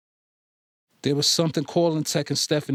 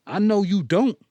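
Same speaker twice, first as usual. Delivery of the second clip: louder, higher, cutting retort; 7 semitones higher, 5 dB louder than usual. Acted. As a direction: throw it over your shoulder. Cutting retort, walking away.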